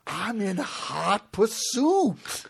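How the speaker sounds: very slow, drawn-out lawman drawl; mock sheriff catchphrase. Host milking a car chase joke, mock sheriff catchphrase. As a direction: stretch every word, milk the catchphrase.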